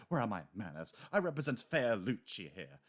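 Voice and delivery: lightly put-on British butler accent; a polite British butler apologizing, lightly voiced; Alfred British accent. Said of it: "like, the British accent".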